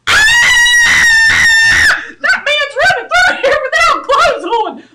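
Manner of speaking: loud, high shocked shout; startled shout. Retold or imitated. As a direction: cry out in alarm, laughing through it. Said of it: "everybody down would go".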